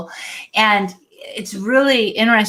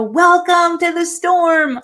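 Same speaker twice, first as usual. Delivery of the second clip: high, drawn-out announcer call; imitated shout from center stage, pitched well up; retold stage yell; 9 semitones higher, 4 dB louder than usual. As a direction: call it out slowly as if greeting a crowd. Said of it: "and yelled".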